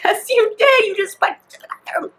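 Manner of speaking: high-pitched comeback in a reenacted squabble; retold exasperated contradiction. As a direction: argue back at a kid, exasperated.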